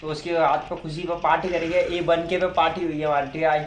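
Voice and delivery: normal talking voice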